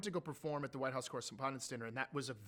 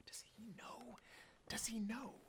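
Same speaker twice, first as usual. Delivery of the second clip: whispered worry; 7 semitones higher, 10 dB quieter than usual. Retold or imitated. Secret worried wondering between partners.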